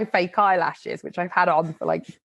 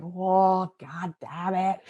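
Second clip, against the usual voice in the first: rueful mutter; 4 dB quieter, much slower than usual. Retold or imitated. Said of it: "and going".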